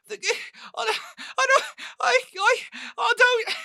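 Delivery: high, terrified stammer; crying and pleading. Acted. Captive unable to answer the marshal, tearful panic. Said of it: "He's crying".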